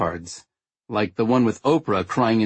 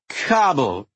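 put-on drunk repeating; 5 semitones higher than usual. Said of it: "I repeated".

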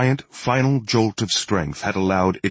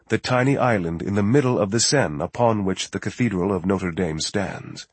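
ordinary delivery

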